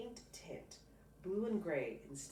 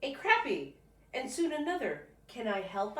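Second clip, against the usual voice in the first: put-on higher, louder eager kid voice; an eager boy at the pond, higher and louder; excited child; 7 semitones higher, 9 dB louder than usual. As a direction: bubble over with eagerness.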